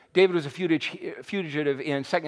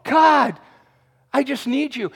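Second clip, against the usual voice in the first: high, loud desperate plea; crying out to God; 9 semitones higher, 5 dB louder than usual. Retold or imitated. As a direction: cry it out, pleading upward. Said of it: "They just cried out, said".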